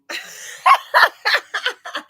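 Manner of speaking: high, loud real laugh; burst of laughter. Real, not acted. Streamer laughing at her chat, loud and high. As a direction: crack up at the comments.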